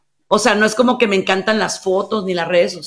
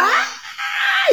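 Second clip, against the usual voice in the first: a child's high shriek, mimicked; imitated tantrum shriek; 4 dB quieter than usual. (grito agudo de berrinche imitado)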